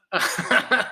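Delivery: short bubbling laugh; amused laugh. Real, not acted. Teacher asked for a llama sound, amused laugh. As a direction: laugh at a tricky request.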